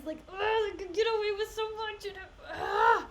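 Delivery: fast, high, half-crying yell; real exasperated near-shout; frustrated outburst. Real, not acted. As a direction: spit it out, fed up with the double standard. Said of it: "it drives me crazy".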